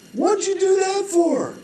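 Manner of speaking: very high, slow, stunned question; shocked outcry. Acted. Bridge man stunned by the jumper, very high, slow disbelief. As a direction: squeal it out in disbelief.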